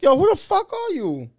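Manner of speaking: very high, incredulous challenge; squeaky-high retold confrontation; incredulous demand. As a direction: act out demanding an explanation from a stranger. Retold confrontation with unknown passenger.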